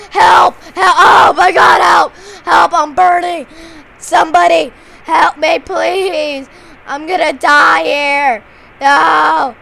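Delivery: panicked screaming for help. Acted. Burning zombie shouting to anyone for help, panicked scream. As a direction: scream like you're on fire.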